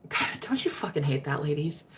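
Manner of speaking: usual speaking voice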